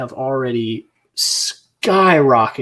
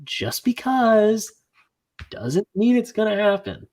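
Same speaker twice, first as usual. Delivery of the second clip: slow, high-pitched, drawn-out protest; exasperated emphasis; 9 semitones higher than usual. Retold or imitated. Friend repeating his rant to drive it home, exasperated. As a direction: stretch out "Just because", then pause.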